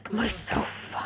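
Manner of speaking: whispered dread. Real, not acted. Dreaded motorway alone.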